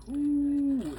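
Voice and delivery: high, squealing ooh of delight. Real, not acted. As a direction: coo at the golden bread. Sees toasted bread, delighted.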